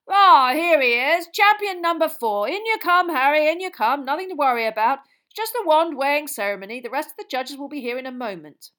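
a jolly official welcoming at full volume; booming Bagman. Acted. Jolly official loudly welcoming Harry into the room, booming and hearty.

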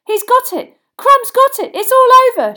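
high, loud cries building; excited shouting. A narrator's reading. Boy sees the match end, thrilled shouting. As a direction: shout out the winning catch. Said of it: "shouted Harry".

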